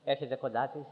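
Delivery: normal talking voice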